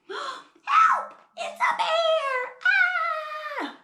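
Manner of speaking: high-pitched mock scream of terror; gasp, then shriek for help; hammed-up panicked camper squeal. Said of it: "who makes the campers scream".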